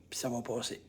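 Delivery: soft whisper; whispered reassurance. Shown as a demonstration. Coach modelling calm self-talk, whispered. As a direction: murmur it to yourself. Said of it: "t'arrêtes pas de le dire".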